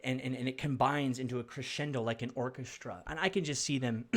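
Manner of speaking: ordinary talk, nothing marked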